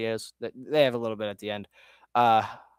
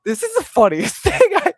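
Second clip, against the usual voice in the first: laughing; 9 dB louder than usual. Real, not acted. Laughing about the video.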